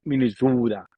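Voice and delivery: "Minnesota" in a put-on Minnesota accent. Shown as a demonstration. Comedian proving he can talk local, Minnesota accent. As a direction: show off your Minnesota accent on "Minnesota". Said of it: "I have my Minnesota accent".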